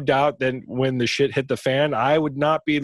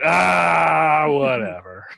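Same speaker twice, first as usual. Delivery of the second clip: lazy zombie moan; 3 dB louder, much slower than usual. Retold or imitated. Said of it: "That's your zombie moan?".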